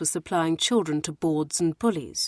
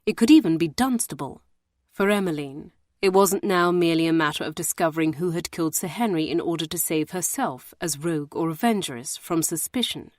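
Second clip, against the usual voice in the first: plain reading voice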